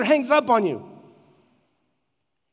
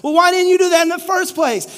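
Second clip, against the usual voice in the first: exasperated shout; 7 semitones higher, 6 dB louder, faster than usual. Retold or imitated. Exasperated customer yells at Traeger.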